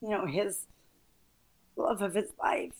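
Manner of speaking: ordinary delivery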